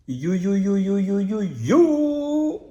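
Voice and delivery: loud, rising yo yo yo; excited greeting. Real, not acted. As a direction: hype up the greeting. Vlog greeting call-out. (salut enthousiaste et fort)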